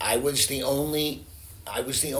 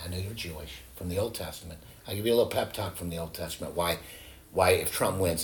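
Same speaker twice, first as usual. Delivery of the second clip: ordinary talk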